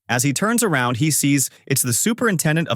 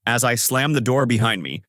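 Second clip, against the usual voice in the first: plain reading voice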